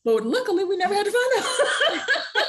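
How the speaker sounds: laughing at the punchline. Real, not acted.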